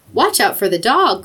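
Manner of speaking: plain reading voice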